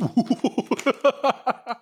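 sudden high burst of laughter. Real, not acted. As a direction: squeal of laughter. Laughs at joke.

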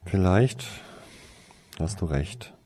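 plain reading voice

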